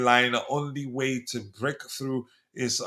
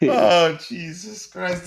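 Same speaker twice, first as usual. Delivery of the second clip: breathy laughing exclamation; amused exasperation; 4 semitones higher, 4 dB louder, much slower than usual. Real, not acted. Host recovering from laughter at a viewer's comment, amused groan. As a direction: let it out still laughing. Reaction after laughing fit.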